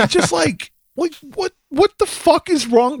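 high, incredulous rant; exasperated disbelief. Retold or imitated. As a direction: squeak out the disbelief. Re-enacting outrage at maskless guy.

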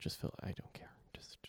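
breathy whispered aside; hushed whisper. Real, not acted. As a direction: drop to a whisper, almost to yourself. Whispered repeat of the plea.

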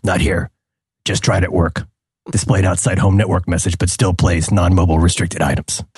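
low terse deadpan read; grim clipped vigilante diary; Rorschach voice. Retold or imitated. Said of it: "reading these as Rorschach".

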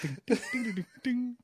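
high-pitched sung-out dings; cartoon dinging. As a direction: imitate a little parade bell, high. Imitating a cartoon parade of Borats.

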